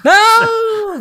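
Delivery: high loud disbelieving cry; shocked no. Real, not acted. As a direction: gasp out a shocked no. Shocked reply to joke.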